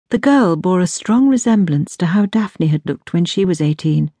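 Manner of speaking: usual speaking voice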